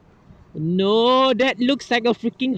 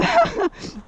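high embarrassed laugh. Real, not acted. Embarrassed after a slip.

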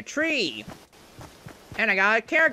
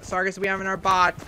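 normal talking voice

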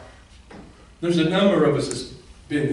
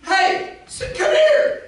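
calling out; 4 dB louder, much slower than usual. Acted. Jesus and woman dialogue.

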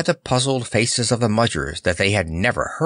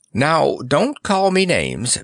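slow, higher-pitched plea; begging; 7 semitones higher, slower than usual. A narrator's reading. Clown pleads with his accusers, slow and mildly begging. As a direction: plead gently, drawing it out. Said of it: "begged Notta".

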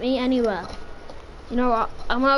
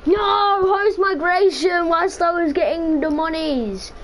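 higher, louder frustrated wail; frustrated cry; 6 semitones higher, 4 dB louder than usual. Real, not acted. Gamer loses his loot to host migration, frustrated wail. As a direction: wail it in outrage. Game interrupted while looting.

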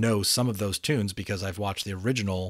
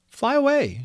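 much higher, louder urging; 6 dB louder than usual. A narrator's reading. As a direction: urge him off, pitched high. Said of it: "she urged him".

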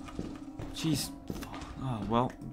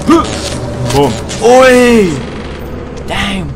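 excited shout; 11 semitones higher, 16 dB louder, much slower than usual. Real, not acted. Celebrating a trick in game.

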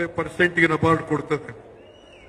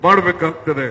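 ordinary delivery

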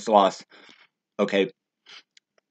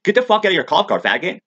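loud, high, hammed-up yell at a cop; retold shout of abuse at the cops; acted yell at cops; 8 semitones higher, 4 dB louder than usual. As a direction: bark it at the cop like you're daring him. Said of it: "It's like".

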